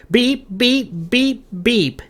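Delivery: high sung-out beep beep; imitated beeping. A narrator's reading. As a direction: mimic an alarm with your voice. Morgan imitating alarm sound.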